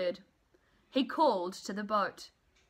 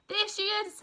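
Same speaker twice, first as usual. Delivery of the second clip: much higher, thrilled; excited spotting; 12 semitones higher than usual. Acted. Baby bird sees the big thing, high and thrilled. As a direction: cry out in joyful relief.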